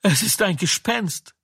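high, startled voice of terror; frightened cry. A narrator's reading. Disciples seeing a figure on the lake, frightened. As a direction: blurt it out in fright at a shape on the water. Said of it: "erschraken sie".

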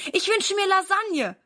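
high, eager wish; boy asks dad for lasagne, excited; delighted. A narrator's reading. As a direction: ask with bright excitement. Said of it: "freut sich Silas".

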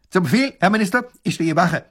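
high, loud soldier's report; military rasping bark. A narrator's reading. Soldier reporting to the minister, military bark. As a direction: snap it out at attention. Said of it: "schnarrt wie vorher im militärischen Ton".

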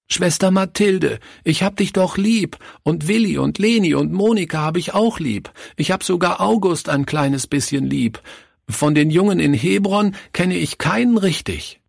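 put-on high, distressed child's voice; pleading little boy. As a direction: plead like an upset small boy, pitched very high. (flehender kleiner Junge, hohe Stimme)

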